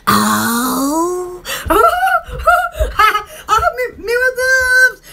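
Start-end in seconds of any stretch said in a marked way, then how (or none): 1.5-3.2 high, breathy laugh bursting out; burst of laughter. Real, not acted. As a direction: laugh it off freely. Laughing at a live chat.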